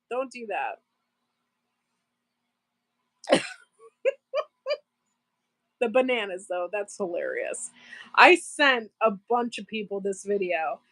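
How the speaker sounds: normal talking voice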